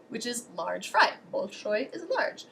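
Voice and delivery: ordinary talk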